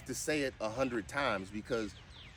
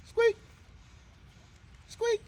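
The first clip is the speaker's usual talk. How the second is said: very high squeaky "squeak", louder; squeaky high command; 5 dB louder than usual. Shown as a demonstration. Owner coaxing her dog to squeak, very high and squeaky. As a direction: squeak the word like a dog toy. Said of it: "Let me hear you squeak it".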